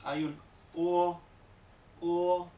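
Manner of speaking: o starting small and opening wide, slow; gliding o vowel. Shown as a demonstration. Teacher shows the o vowel opening slowly. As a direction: grow the o from a tight u shape. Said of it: "Va desde la vocal un poco más pequeña".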